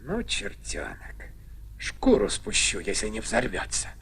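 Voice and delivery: very low, nearly whispered grumble; muttered threat. Acted. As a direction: mutter a threat under your breath. (угроза сквозь шёпот)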